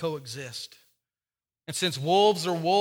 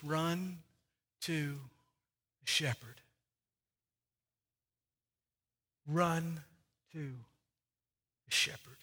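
soft slow plea; 4 semitones lower, 11 dB quieter, much slower than usual. Acted.

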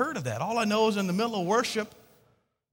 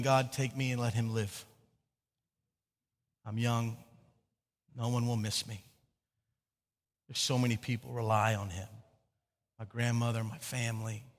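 low, hushed, sorrowful pleading; softly beg, pausing between phrases, without sobbing; tearful prayer retold; 10 semitones lower, 7 dB quieter than usual. Teen praying for dying grandfather, quiet sorrowful plea. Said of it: "and just weeping, praying".

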